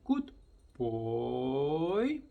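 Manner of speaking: put-on drawn-out, bright good boy; warmer, livelier praise; lively good boy. Shown as a demonstration. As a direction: say it like you mean it. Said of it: "he start listening".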